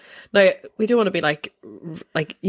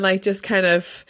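ordinary talk

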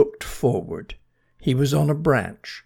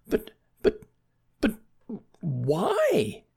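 flustered stammer; 10 semitones higher, slower than usual. Acted. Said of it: "asked Bernie".

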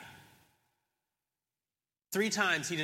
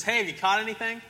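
lightly imitated call, pitched higher; mild calling out; 6 semitones higher, faster than usual. Voice from the shore asking the fishermen, mildly calling out. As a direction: call over the water without really shouting. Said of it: "they hear this voice from across the shore".

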